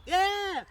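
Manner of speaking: sudden high, loud scream; imitated pained yell. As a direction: yelp as if just bitten. Someone yells as dog snaps.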